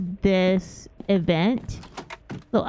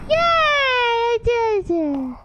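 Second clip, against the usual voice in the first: squealing cheer, much higher and drawn out slowly; excited cheer; 13 semitones higher, slower than usual. Real, not acted. Streamer cheering after beating the puzzle, squealing. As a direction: celebrate like you just won. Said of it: "Sorry, I was just really excited".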